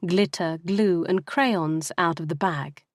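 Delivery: ordinary delivery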